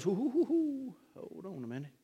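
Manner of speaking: a hint of a gentle herdsman soothing; calming the flock. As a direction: coo like a shepherd calming a nervous ewe. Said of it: "Shepherd shows up and goes".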